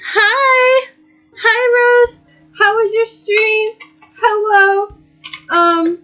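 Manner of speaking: high, loud squeal of hello; excited greeting. Real, not acted. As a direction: greet a friend with glee. Greeting a friend in chat.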